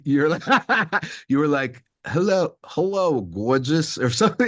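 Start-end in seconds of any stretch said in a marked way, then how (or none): 2.1-4.0 hammed-up English accent, higher; a playful attempt at an English accent. Interviewer imitating Nell's English accent for her, playful mock English accent. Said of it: "I'm gonna butcher it and embarrass myself".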